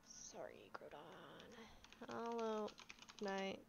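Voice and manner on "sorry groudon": whispering. Real, not acted. Whispered apology to a sleeping friend.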